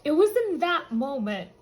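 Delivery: ordinary talk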